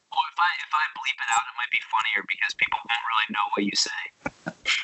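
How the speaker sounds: as if through a nineteen fifties radio. Real, not acted. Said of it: "What just happened to your voice?".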